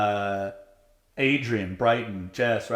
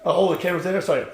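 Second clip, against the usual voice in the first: high, loud mock-eager chatter with a laugh; fake camera voice; 7 semitones higher, 5 dB louder than usual. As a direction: play up the fake charm, laughing. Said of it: "I'm just being fake as hell".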